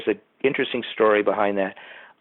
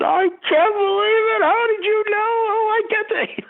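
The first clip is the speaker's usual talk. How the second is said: very high, bright excited voice quoting the actor; mock amazed falsetto; excited high quote; 4 dB louder than usual. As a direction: squeal it in disbelief.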